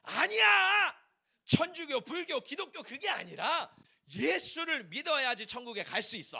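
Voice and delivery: imitating an incensed student, high loud outburst; acted fiery outrage. Elite church student correcting the others about Jesus, acted outrage. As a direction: explode on the first word, then hammer out the mock rebuttal. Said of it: "이 친구가 열불이 난 거예요".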